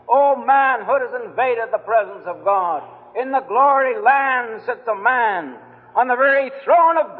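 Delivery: fervent preaching. Acted. Preacher pleading with the room to receive Christ, fervent preaching. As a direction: preach it out, urging every sinner in the room.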